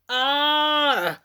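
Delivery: drawn-out, high mini bear roar; little bear roar. A narrator's reading. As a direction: let out a playful little bear-cub bellow. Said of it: "brüllte Lenny einen lauten Mini-Bärenbrüller". (kleiner Bärenbrüller)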